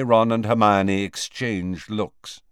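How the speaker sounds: usual speaking voice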